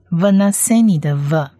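usual speaking voice

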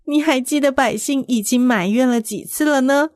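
ordinary delivery

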